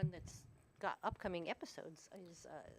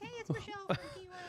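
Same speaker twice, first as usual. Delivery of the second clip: mock bright caller voice; 12 semitones higher, faster than usual. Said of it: "It's like".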